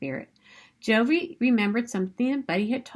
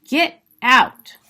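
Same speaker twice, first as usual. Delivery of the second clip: loud, sharp "Get out!"; angry dad; 3 semitones higher, 10 dB louder than usual. Acted. Dad throws Buddy out of the meeting, loud angry shout. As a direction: bark him out of the room.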